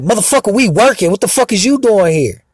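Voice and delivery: high mock voice of the rude workers. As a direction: imitate them talking trash. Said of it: "start talking shit like".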